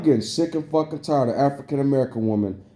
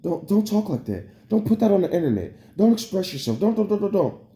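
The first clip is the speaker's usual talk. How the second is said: a lightly voiced scolding parent, higher; mocking nagging parents; 6 semitones higher than usual. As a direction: imitate mom and dad nagging "don't". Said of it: "I just learned this about my mom and dad".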